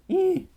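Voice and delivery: short, very high squeal; happy squeal. Real, not acted. Streamer cuddling an old plush, squealing. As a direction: let out a tiny high "eee".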